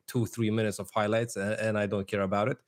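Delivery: ordinary talk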